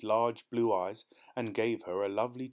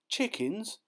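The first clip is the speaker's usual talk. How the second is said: very high disbelieving rise; incredulous; 12 semitones higher than usual. A narrator's reading. Boy echoing his aunt, aghast. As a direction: echo the word in pure shock.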